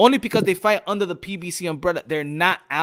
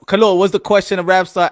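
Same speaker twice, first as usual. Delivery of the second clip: calling out; 8 dB louder than usual. Real, not acted. Moderator calls panelists to order.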